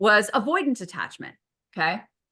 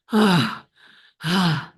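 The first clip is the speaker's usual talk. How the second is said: mock panting; 3 semitones lower, 5 dB louder than usual. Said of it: "exhausted and panting".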